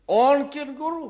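incredulous. Acted. Appraiser mocks the claim.

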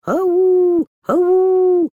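high, loud calling shout. Acted. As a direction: call out for the missing girls. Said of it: "Мама кричит".

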